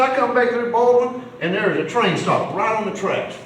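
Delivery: mildly acted stressed complaint; stressed-out wife. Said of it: "stressed out".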